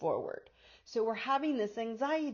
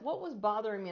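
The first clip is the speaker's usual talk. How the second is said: ordinary talk, nothing marked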